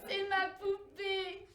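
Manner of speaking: put-on slow, high childish insistence; little girl. Little girl answering her mother, slow high insistence. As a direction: plead like a small girl. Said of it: "répondait la petite fille".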